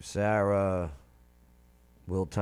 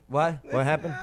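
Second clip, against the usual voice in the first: ordinary delivery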